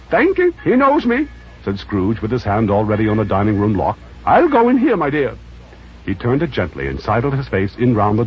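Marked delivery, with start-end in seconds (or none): 0.1-1.3 put-on high, thin elderly voice; pleading old man, pitched way up; old Scrooge voice. Scrooge begging the charity gentleman, high old voice. 4.3-5.3 put-on high, thin elderly voice; pleading old man, pitched way up; old Scrooge voice. Scrooge begging the charity gentleman, high old voice.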